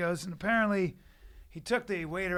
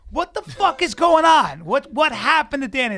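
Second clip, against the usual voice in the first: loud, high, slow-building outburst; retold forceful confrontation; heavy demand; 5 semitones higher, 9 dB louder than usual. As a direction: demand the truth, fed up. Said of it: "it gets heavy".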